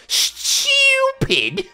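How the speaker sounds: high, drawn-out British "stupid", hammed up; exaggerated British accent on a stretched-out insult; heavy British accent. Gamer mimicking a mean party member's catchphrase, heavy British accent. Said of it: "very heavy British accent".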